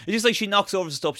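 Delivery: normal talking voice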